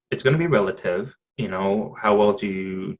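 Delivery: ordinary delivery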